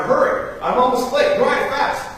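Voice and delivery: high, loud rush to the driver; hurried urging. Retold or imitated. Huxley urging the Dublin cab driver on, high and loud. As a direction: hurry him, you're late.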